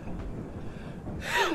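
high unvoiced gasp-laugh; breathless shocked laugh. Real, not acted. Gamer laughing breathlessly after the scare. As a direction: laugh off the fright.

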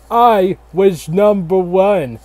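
slow, puffed-up presidential boast; lightly voiced George Washington bragging; mock presidential boast. George Washington bragging to the nation, slow puffed-up boast.

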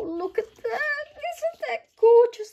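high squeal of delight over the paper. Real, not acted. Crafter squealing over her new koala paper, high and loud. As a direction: gush at the koala paper like a thrilled fan.

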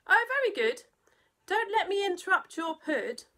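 high, loud cheerful reply; farmer's hearty goodbye; jolly farmer. Acted. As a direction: brush it off heartily. Farmer replies.

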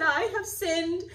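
high, loud, slow broken plea; a king begging in a high cracking voice; pleading Pharaoh. A narrator's reading. Pharaoh confessing to Moses, high desperate plea. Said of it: "He confessed".

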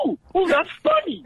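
high, giddy ooh-ooh, laughing through it; gleeful mock excitement. Mimicking his friend's giddy excitement at the table. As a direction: play the eager newcomer, thrilled at the laugh.

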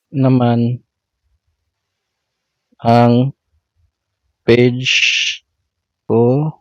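words spaced out one by one while typing; slow dictation. Real, not acted. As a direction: say each word slowly as if typing it.

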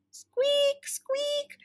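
very high thin squeaks; drawn-out high-pitched squeak; squeaky pump handle. A narrator's reading. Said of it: "The pump handle hopped up and down".

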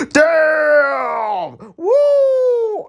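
high whooping cheer; excited shout. Real, not acted. Streamer cheering the savage boy, excited shout. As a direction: whoop at the screen.

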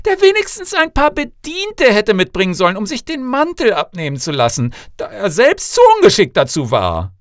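loud, high haughty complaint; scornful princess. Acted. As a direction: pick the suitor apart, high and indignant. Princess rejects second suitor.

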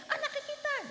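high tearful wail; crying mother. Retold or imitated. Mother answering while weeping at the dock, high wail. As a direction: wail it out in a cracking voice. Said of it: "Ari wis kaya kononku".

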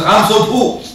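mock pleading poor man; whiny excuse. Preacher acts a man pleading poverty as his excuse, mock whine.

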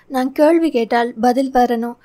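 ordinary delivery, nothing marked

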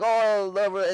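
loud, high, gleeful imitation; mocking buddy. Satanist buddy laughs off warning, mocking glee. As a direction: crow it like a rowdy party buddy.

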